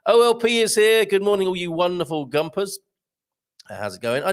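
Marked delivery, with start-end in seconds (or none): none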